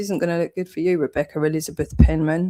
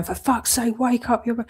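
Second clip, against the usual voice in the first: higher, mocking voice of someone pleading wake up; mocking exasperated critic; 6 semitones higher than usual. Mocking the people who keep telling her to wake up, high and exasperated. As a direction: send up a frantic nagger, pitched up. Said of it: "people running around going".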